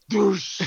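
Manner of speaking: sudden loud, high "doosh"; imitated soda crash. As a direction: burst out with a splashy crash noise. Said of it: "and then you hear".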